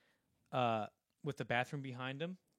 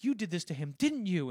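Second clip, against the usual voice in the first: raised, loud accusing wife; imitated accusation; 6 semitones higher, 7 dB louder than usual. Wife accuses husband over son's bruises, imitated high accusation. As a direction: mimic an upset mother's accusation, climbing higher.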